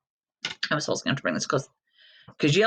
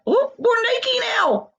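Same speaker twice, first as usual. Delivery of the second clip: much higher squeaky crayon wail. Retold or imitated. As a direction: pitch it way up in mock embarrassment. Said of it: "They're like".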